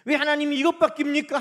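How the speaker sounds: high, loud grievance; complaining to God. Acted. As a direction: protest as if short-changed.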